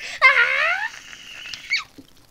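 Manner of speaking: loud, high-pitched scream. Real, not acted. Player who will scream and then die, high scream. Friend says he'll scream.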